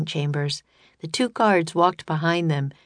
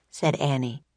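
ordinary delivery, nothing marked

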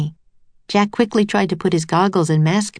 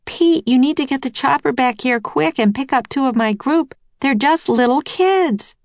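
high, agitated insistence; urgent radio plea; 8 semitones higher than usual. A narrator's reading. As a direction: press him urgently, pitch held high. Nancy urgently radios for helicopter.

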